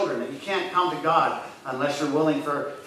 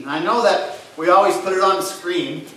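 ordinary talk

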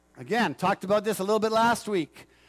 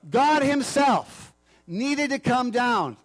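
raised, measured preacher's appeal; emphatic preaching; 4 semitones higher, 3 dB louder than usual. Real, not acted. Preacher urging listeners to receive the gift, emphatically. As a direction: press each phrase home with conviction.

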